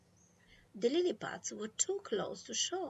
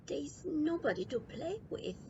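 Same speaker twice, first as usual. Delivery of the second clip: complaining; 7 semitones higher than usual. A narrator's reading. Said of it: "I complained".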